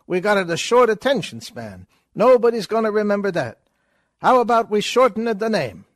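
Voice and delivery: put-on fast, high, brash stage Italian accent; hammed-up vaudeville Italian accent, pushing the joke; Chico Italian accent. Chico offering Groucho Delaware, in a loud stage Italian accent.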